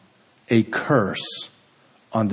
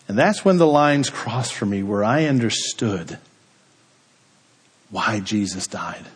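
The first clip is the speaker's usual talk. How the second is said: ordinary talk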